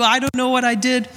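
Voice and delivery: high, strained protest; mock panicked answer. Husband protesting to his wife about the flood, high mock panic. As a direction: protest high and flustered, acting it out.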